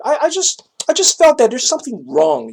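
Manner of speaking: usual speaking voice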